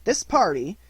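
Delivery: put-on a child proudly making a speech; Christopher Robin's voice. A narrator's reading.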